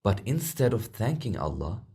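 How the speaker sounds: ordinary delivery, nothing marked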